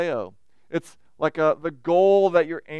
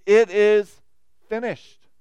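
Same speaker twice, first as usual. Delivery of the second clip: loud proclaimed cry of triumph; a loud last cry; 3 semitones higher, 8 dB louder, slower than usual. Retold or imitated. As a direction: call it out, ringing. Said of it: "he cries out".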